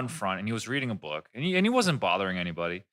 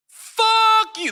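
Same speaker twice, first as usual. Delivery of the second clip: high, loud outraged yell; sudden explosive shout; 8 dB louder than usual. Retold or imitated. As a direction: blow up and yell it at full volume. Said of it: "I legit yelled that".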